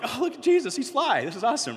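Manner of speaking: high, thrilled fanboy outburst; squealing with delight at meeting him; excited gush. Retold or imitated. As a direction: gush like a starstruck fan. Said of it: "and I'm like".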